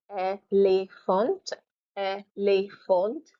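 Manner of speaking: "Elephant" slowly as ay - lay - FONT, T sounded; feminine, syllable by syllable. Shown as a demonstration. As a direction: split "Elephant" in three with a rising end. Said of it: "pronouncing the feminine form".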